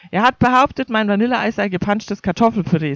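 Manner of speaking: ordinary delivery, nothing marked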